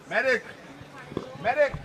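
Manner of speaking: urgent high shouted call for help; man down shout. Real, not acted. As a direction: yell across the field for a medic. Calling for help on track.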